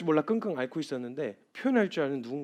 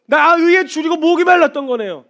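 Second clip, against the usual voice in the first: loud, high cry of thirst; 의에 cried out high and loud; impassioned confession; 12 semitones higher, 14 dB louder than usual. Acted. Preacher crying out his confession to the congregation, loud and high.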